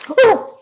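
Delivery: sudden loud high-pitched ooh; shocked oops cry. Real, not acted. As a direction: gasp at your own words. Reaction after saying shut up.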